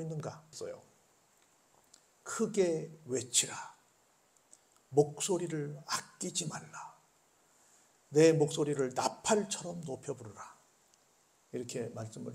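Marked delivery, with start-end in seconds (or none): 2.2-10.6 slow, measured scripture reading; solemn verse read at a slow pace. Acted.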